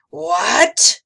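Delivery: high, very loud burst; outraged yell. A narrator's reading. Gabe learning Emma isn't afraid, outraged yell. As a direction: explode in disbelief. Monster outraged at fearless child.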